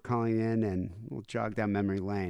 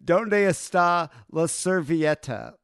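slow, higher, louder clueless tourist; mock tourist Spanish; 6 semitones higher, 6 dB louder, slower than usual. Friend asking women for a napkin in slow, loud mock tourist Spanish. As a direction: spell out the Spanish slowly, too loud. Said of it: "Or he'd be like".

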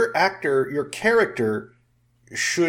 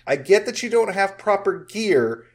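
exasperated rant; 7 semitones higher, 6 dB louder than usual. Retold or imitated. Mock rant at the on-screen character.

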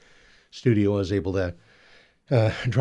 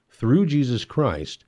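ordinary delivery

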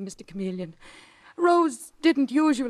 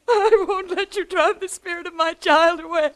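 tearful, louder plea with a sobbing laugh; crying protest; 5 semitones higher, 4 dB louder than usual. Acted. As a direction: beg her to stop, in tears. Mother pleads, crying.

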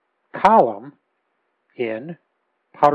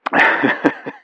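hearty laugh; 6 dB louder than usual. Real, not acted. Laugh before joke.

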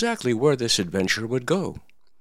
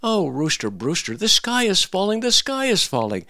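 frantic hen crying the alarm; 11 semitones higher than usual. Acted. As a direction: plead with the rooster in a fluster. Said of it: "she cried".

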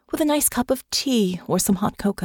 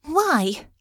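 demanding; 9 semitones higher than usual. A narrator's reading. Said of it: "Honor demanded".

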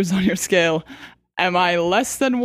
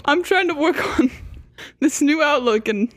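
laughing; 6 semitones higher than usual. Real, not acted. Laughs while speaking.

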